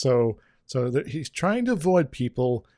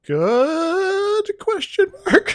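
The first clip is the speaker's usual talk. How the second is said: high squeaky unsure question; rising question; much slower than usual. Acted. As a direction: lift it way up like asking. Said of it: "I guess".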